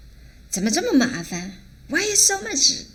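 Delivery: acted exasperation, pitch leaping up; put-upon, high-pitched why; bothered complaint. Shown as a demonstration. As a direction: protest as if bothered too much. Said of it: "when somebody bother you too much".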